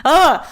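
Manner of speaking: a loud, high groan through real tears; tearful groan. Real, not acted. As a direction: let out a choked ugh while crying. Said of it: "I didn't expect to cry".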